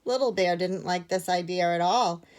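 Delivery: plain reading voice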